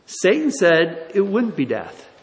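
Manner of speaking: ordinary talk, nothing marked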